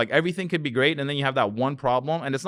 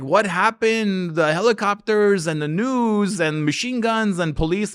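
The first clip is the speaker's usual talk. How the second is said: higher, slow, alarmed questioning; alarmed neighbors; 8 semitones higher, 5 dB louder than usual. Retold or imitated. Neighbors asking what happened, alarmed and high. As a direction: ask like a shocked neighbor.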